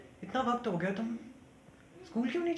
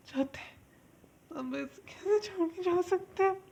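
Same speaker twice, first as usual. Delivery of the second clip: wailing higher and higher through sobs; crying; 7 semitones higher than usual. Acted. Daughter learns her father is dead and breaks down wailing. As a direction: grief spilling over into wails.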